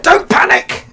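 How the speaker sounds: sudden high yell, at full force; a panicking man shouting at the crowd; panicked shout. Retold or imitated. Panicking man in the crowd yells, high and loud. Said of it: "and he said".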